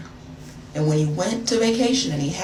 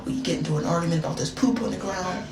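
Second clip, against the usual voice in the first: normal talking voice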